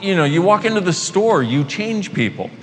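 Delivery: normal talking voice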